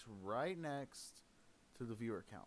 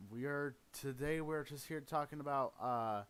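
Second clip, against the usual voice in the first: ordinary delivery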